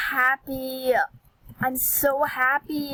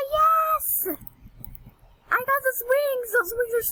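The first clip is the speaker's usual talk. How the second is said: much higher, joyful victory squeal; thrilled cheer; 11 semitones higher than usual. Real, not acted. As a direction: cheer like you just won. Wins the wished-for wings.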